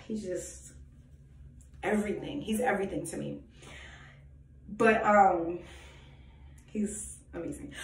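hushed, breathy words of adoration; real tender whisper. Real, not acted. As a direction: whisper it like a love confession. Tender devotion to God.

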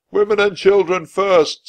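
put-on high, loud shout of Women and children first. A narrator's reading. A crewman orders women into the boats, shouting. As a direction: bellow the order across the deck. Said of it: "someone shouted".

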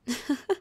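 short, high mocking laugh; amused chuckle. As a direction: chuckle at a feeble insult. Vampire amused by hunter's insult.

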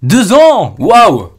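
loud, swooping disbelief; amazed. Real, not acted. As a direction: exclaim it in amazement. Amazed at long-time subscriber.

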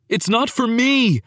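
high-pitched, hasty denial; quick protest. A narrator's reading. Antonio defending himself to Paolo, quick high protest. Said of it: "Antonio said quickly".

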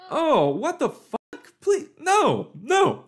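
high, loud pleading no; horrified shout. Real, not acted. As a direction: recoil in disgust, yelling. Horrified reaction to video.

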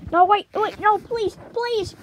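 high, loud desperate pleading; loud begging. Acted. Eliminated toy pleads loudly with the host, frantic begging. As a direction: beg loudly to be spared.

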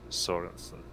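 normal talking voice

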